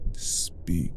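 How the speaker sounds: whispered, clipped order; whispered command. Acted. Vampire ordering her new pet to speak, whispered command. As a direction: hiss the command softly, expecting obedience.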